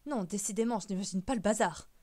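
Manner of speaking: loud, grumbling disbelief; indignant old relative; exasperated great-uncle. Acted. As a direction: push it out forcefully, rejecting the whole idea. Great-uncle resumes his grumbling.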